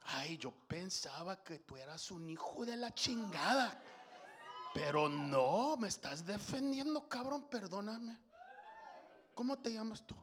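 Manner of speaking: soft, quick Mexican Spanish voice; Mayra's Mexican Spanish. Retold or imitated. Mayra apologizing to Carlos, soft Mexican Spanish. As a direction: gush it like a grateful cashier. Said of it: "And she goes".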